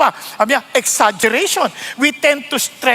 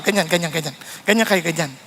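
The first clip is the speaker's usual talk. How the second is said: soft demo; 8 semitones lower, 5 dB quieter than usual. Shown as a demonstration. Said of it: "ganyan ka daw muna".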